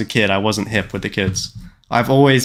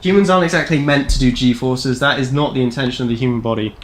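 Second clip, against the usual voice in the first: ordinary talk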